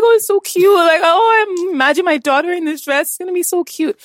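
high squealing gush over cute dresses; hammed-up high coo; gushing mom. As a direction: coo like a doting mother over baby clothes. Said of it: "you're like".

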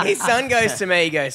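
ordinary talk, nothing marked

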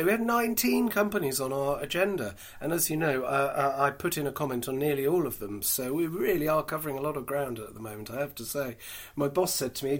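ordinary talk, nothing marked

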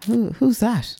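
high, confused double take; mock surprise at a stranger; puzzled who's that. Imitating herself meeting a date who looks different.

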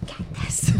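hushed conspiratorial whisper. Retold or imitated. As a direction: breathe it like office gossip. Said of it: "being like".

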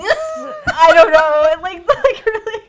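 loud high laughing words. Real, not acted. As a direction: crack up while trying to talk. Laughing at the nose-picking card.

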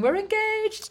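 high mock scream of joy; retold scream. Couple on the rocks announcing the news, high excited mock yell. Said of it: "started screaming".

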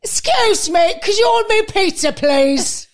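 high, loud mocking client voice; mock the demanding advertiser; entitled client impression. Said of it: "Like, fuck off".

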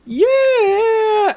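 squeaky high held yeah; high falsetto. Pretend. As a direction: sing it up high and long. High sung agreement.

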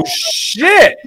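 very high, breathless disbelief; can't believe what you just heard; stunned. Retold or imitated. Father reeling at son's insult, very high stunned gasp.

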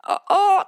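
loud high mock retching groan; hammed-up pained moans from a stall; sick groan. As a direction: groan like someone throwing up.

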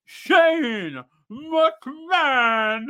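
slow, drawn-out ring announcer call, rising high; ring announcer intro. Acted. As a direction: belt it out like an arena announcer introducing a star. Announcer intro of Shane McMahon.

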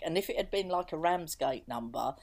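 ordinary talk, nothing marked

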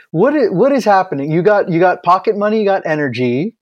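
ordinary delivery

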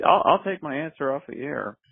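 ordinary delivery

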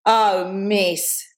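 long drawn-out, slow teasing drawl; slow schoolgirl drawl; mocking drawl. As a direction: drawl it lazily to needle the teacher. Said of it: "Dawn drawled".

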